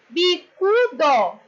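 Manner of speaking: BICUDO slowly syllable by syllable, weight on CU; stress on CU. Shown as a demonstration. Teacher showing pupils the tonic syllable, slow BICUDO with stress on CU. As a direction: sound out BI-CU-DO, leaning on CU. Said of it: "sílaba tônica".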